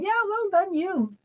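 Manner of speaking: high, mock-cheerful well done to herself; wry self-praise. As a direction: congratulate yourself with a bitter wink. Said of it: "looking at my award and saying".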